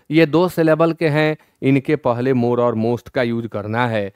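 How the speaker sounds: ordinary talk, nothing marked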